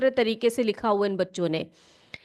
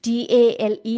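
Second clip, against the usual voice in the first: slow spelling; slower than usual. Shown as a demonstration. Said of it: "इस टेल का अर्थ क्या होता है?".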